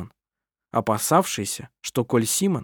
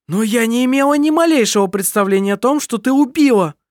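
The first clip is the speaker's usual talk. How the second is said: high, loud shocked protest; mother protests in horror; loud high horrified protest; 10 semitones higher, 5 dB louder than usual. A narrator's reading. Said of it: "запротестовала мать".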